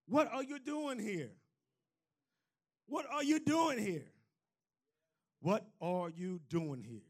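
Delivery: soft, almost breathed repetition of the question; hushed voice of God. Acted. Preacher quietly echoing God's question to Elijah, hushed. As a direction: let it fall to a near hush.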